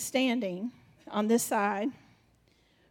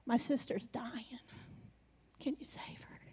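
whispered plea; 12 dB quieter than usual. Acted. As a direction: whisper the desperate request under your breath. Pleading prayer acted out.

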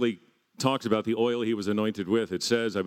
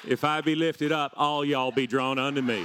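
Southern-drawling Jesus, pitched up; Southern accent; 3 semitones higher than usual. Acted. Jesus from Arkansas addressing followers in Southern accent. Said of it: "he's from Virginia or Arkansas".

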